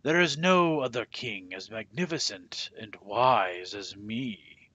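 loud, self-satisfied boast; boastful. A narrator's reading. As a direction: crow about your own greatness. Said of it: "he boasted".

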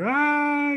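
high, drawn-out mock wail of panic; mock panicked scream. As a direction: imitate someone panicking with a high "aah". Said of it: "everyone's just running around panicking".

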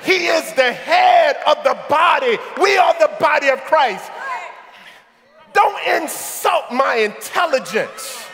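ordinary talk

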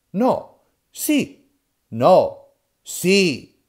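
loud, high no-yes squabble; two old men yelling over each other; shouted back and forth. Acted. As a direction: bark each word like a brawl.

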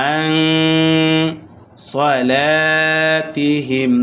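nasal hum held on the "ন" of আন; slow আন সলাতিহিম, nasalised join into স; ikhfa ghunnah. Shown as a demonstration. Teacher showing ikhfa, long nasal আন before সলাতিহিম. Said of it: "গুন্নার সহিত".

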